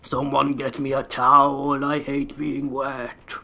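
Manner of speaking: slow, drawn-out whine, mocking the enemy; mocking whiny wraith. Gamer mocking a slow wraith as a whiny sulk. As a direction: stretch every word out like a sulky wet wraith. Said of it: "ponce around the place going".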